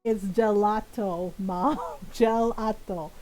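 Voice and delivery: drawn-out "gelato", syllable by syllable; slow, weary repetition. Retold or imitated. Grown child repeating "gelato" for mom, slowly. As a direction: say "gelato" slowly with comic patience.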